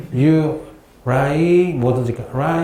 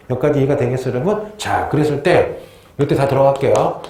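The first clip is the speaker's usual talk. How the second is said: ordinary talk, nothing marked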